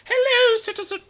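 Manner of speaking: high trilling receptionist greeting; sing-song. Acted. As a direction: chirp it like a well-rehearsed clerk. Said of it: "trills in a sing-song voice".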